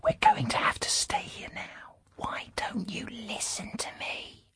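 very soft, breath-only whisper; barely audible whisper; tiny whisper. A narrator's reading. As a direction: scold in the faintest whisper from hiding. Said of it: "Lyra heard a tiny whisper".

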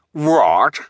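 loud, high indignant snap; snapping. A narrator's reading. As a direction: snap it back in disbelief. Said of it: "the bald man snapped".

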